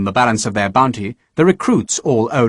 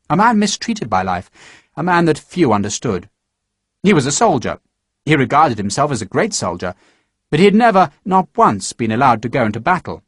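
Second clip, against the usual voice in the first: usual speaking voice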